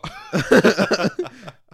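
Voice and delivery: loud, high burst of laughter; real laughter. Real, not acted. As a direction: crack up loudly. Amused at being called angry.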